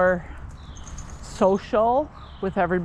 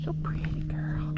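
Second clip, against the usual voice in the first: soft low whisper to cat; 4 semitones lower, 6 dB quieter than usual. Real, not acted. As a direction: whisper tenderly.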